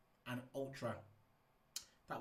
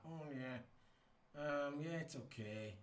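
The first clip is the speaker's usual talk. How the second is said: unfazed; 2 semitones higher, 4 dB quieter, much slower than usual. Retold or imitated. Unfazed race winner answering the post-race interviewer, imitated coolly. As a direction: mumble it like nothing matters. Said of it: "Nothing phases him".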